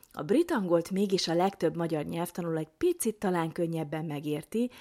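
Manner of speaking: ordinary delivery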